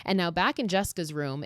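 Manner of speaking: normal talking voice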